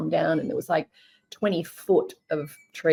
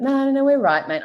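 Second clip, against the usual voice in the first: higher, louder imitation of a polite brush-off; gentlemanly refusal; 7 semitones higher, 4 dB louder than usual. Sean turns down the offer of shelter, imitated gentlemanly refusal. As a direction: lightly mimic him politely waving off help. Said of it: "very gentleman".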